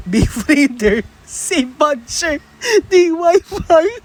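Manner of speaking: slow, high, hammed-up whimper; fake-sob the answers for laughs; mock crying. Reading out teacher quiz answers as a slow, high fake sob.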